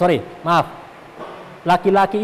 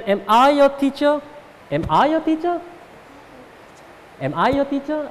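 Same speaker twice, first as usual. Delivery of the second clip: "Am I your teacher?" climbing high on "teacher"; rising intonation; 9 semitones higher than usual. Shown as a demonstration. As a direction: lift "Am I your teacher?" into a high-pitched question. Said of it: "intonasinya naik". (intonasi naik tinggi pada "Am I your teacher?")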